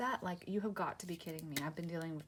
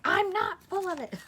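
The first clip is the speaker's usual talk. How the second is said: mock protest; 13 semitones higher, 9 dB louder than usual. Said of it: "And I'm like".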